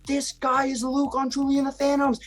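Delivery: put-on a young fan telling her friends, voice up high; excited little girl.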